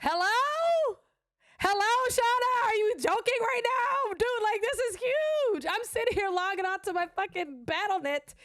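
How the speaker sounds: high, incredulous, thrilled to her humble friend; exasperated excitement. Retold or imitated. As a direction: shake her friend awake with a high squeal. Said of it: "I said".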